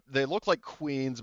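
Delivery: ordinary talk, nothing marked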